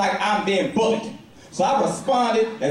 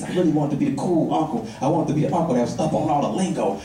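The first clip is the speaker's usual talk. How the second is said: usual speaking voice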